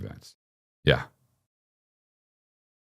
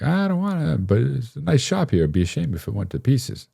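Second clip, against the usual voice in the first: high, wheedling mobster menace; Tony Soprano; 8 semitones higher than usual. Retold or imitated. Eight-year-old blackmailer leaning on a classmate, mock mobster. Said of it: "coming at me like Tony Soprano".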